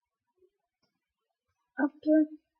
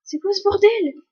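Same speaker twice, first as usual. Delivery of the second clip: high, loud what the hell; shocked outburst; 8 semitones higher, 5 dB louder than usual. Real, not acted. Player startled by the game reset, loud shocked question. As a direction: blurt it out in disbelief.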